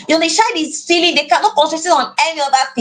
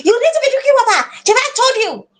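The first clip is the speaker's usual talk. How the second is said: much higher, fast scolding command; harsh scolding; 9 semitones higher, faster than usual. Shown as a demonstration. Frustrated parent scolding child about water, harsh. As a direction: snap at the child impatiently. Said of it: "And somebody, eh".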